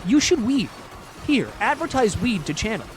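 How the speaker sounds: high, loud, clueless broken-English pitch; hammed-up pushy salesperson with questioning lilts; mock sponsor rep. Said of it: "sponsorships that are like".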